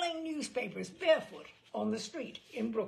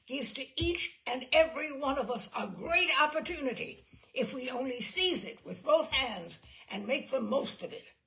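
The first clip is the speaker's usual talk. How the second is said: loud, higher, rousing speech; shouting oration; 4 semitones higher, 7 dB louder than usual. Retold or imitated. Gangster on his feet orating about opportunity, loud shout. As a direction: shout it like the chairman of a board meeting. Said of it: "he shouted".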